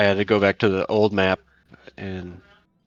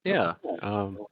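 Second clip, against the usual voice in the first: usual speaking voice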